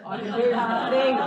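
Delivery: the mantra belted out; loud mantra. Retold or imitated. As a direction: shout it as the big punchline. Mantra punchline shouted.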